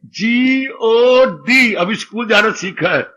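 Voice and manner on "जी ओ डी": very slow, letter by letter "जी ओ डी"; slow spelling out. Acted. Child just started school spelling aloud, slow letter by letter. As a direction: spell it like a beginner, each letter stretched.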